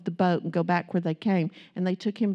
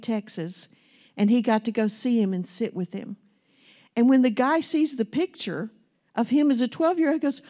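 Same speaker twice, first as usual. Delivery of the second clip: ordinary delivery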